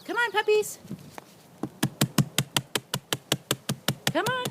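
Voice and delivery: high loud sing-song call. Real, not acted. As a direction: call the puppies in a bright coo. Calling puppies.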